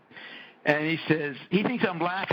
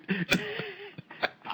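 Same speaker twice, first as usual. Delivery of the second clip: high laugh. Real, not acted. After quoting his scolding wife, a high laugh. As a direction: let a high laugh slip out.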